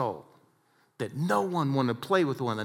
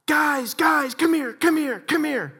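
much higher and louder calling out; excited call; 14 semitones higher, 7 dB louder, slower than usual. Retold or imitated. Golfer in the bunker calling friends over, high excited shout. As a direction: yell for the guys from the bunker. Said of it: "And we hear this".

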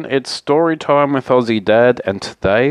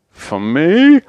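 high, louder, rising disbelief; surprised question; 7 semitones higher, 5 dB louder than usual. Acted. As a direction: let it climb right up in astonishment. Bear flattered by bigger cave offer.

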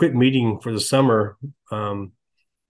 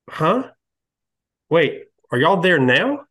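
retold puzzled surprise; 5 semitones higher, slower than usual. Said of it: "I can just see she's deflated".

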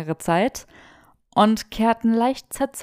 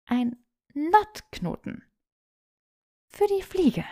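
patient sigh; 8 semitones higher, slower than usual. A narrator's reading. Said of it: "seufzte Granger geduldig".

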